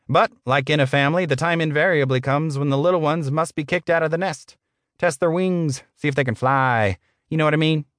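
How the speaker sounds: high-pitched, falsely genial boss; smarmy boss. Acted. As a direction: play the paternal manager in a put-on voice.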